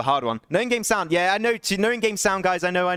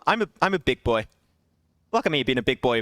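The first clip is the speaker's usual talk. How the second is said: usual speaking voice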